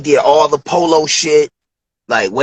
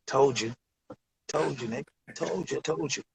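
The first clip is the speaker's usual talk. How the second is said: softer, lower told-you-so; smug aside; 3 semitones lower, 17 dB quieter than usual. Retold or imitated. Dilla quietly gloats to his friend, soft and smug. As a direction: murmur it with a knowing grin.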